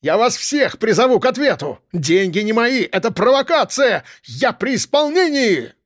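high-pitched outraged cries, rising to the end; defiant protest. A narrator's reading. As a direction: keep threatening as you are dragged off. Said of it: "не сдавался Ананьин".

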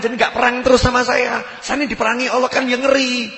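high, insistent mock-tearful plea; acted tearful outburst. Robber explains he lost to Satan, acted tearful high plea. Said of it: "Nangis dia".

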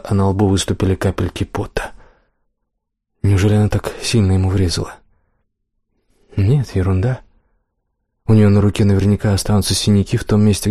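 plain reading voice

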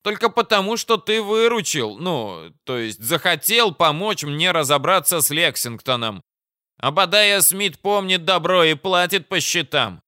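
high-pitched, self-important commander; proud officer setting out conditions; blustering commander, grudgingly trusting. Acted.

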